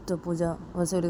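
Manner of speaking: ordinary delivery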